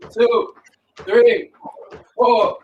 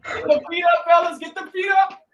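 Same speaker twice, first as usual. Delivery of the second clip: urging shout; 12 semitones higher than usual. Real, not acted. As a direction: bark it across the gym. Coach urging butt kicks.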